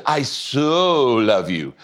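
slow, gushing I so love you ending in a laugh; passionate guy. Retold or imitated. Pastor mimics a guy who so loves a girl, hammed-up and gushing. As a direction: lean hard on so like a smitten guy, then laugh. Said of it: "now he really means it".

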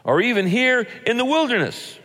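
very high-pitched mock wail. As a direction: keep the complaining wail up high. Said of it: "they wailed".

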